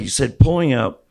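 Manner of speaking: usual speaking voice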